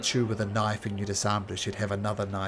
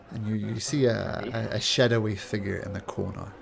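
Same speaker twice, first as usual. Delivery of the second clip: usual speaking voice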